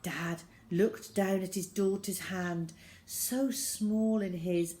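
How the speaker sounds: ordinary delivery, nothing marked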